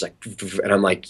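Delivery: ordinary talk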